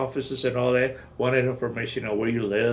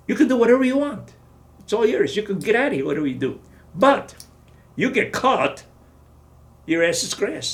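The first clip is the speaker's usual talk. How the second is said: higher, fast, louder warning; retold colonel warning; 9 semitones higher, 4 dB louder than usual. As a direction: warn him forcefully.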